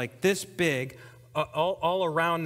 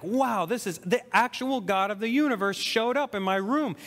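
higher, excited outburst of wonder; joyful amazement; 6 semitones higher than usual. Retold or imitated. New believer overjoyed that God is speaking to him, amazed. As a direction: exclaim it as if God just walked in. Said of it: "I just feel so joyful".

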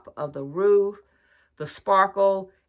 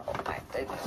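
breathless hush of wonder; awed whisper; 5 semitones lower, 10 dB quieter, faster than usual. Real, not acted. Vlogger hushed in awe at a beautiful house, whispering.

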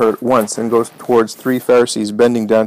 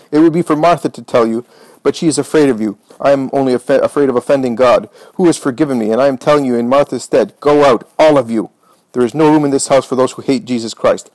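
ordinary delivery

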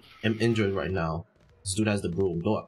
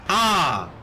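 pained yelp; 8 semitones higher, 5 dB louder than usual. Real, not acted. Gets punched again.

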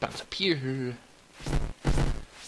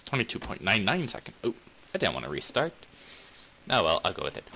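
ordinary talk, nothing marked

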